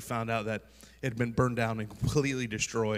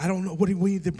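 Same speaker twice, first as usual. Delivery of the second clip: higher, louder, flustered mock alarm; retold panic; 8 semitones higher, 6 dB louder, faster than usual. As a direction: replay his own startled scramble. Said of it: "I was so afraid 'cause I'm like".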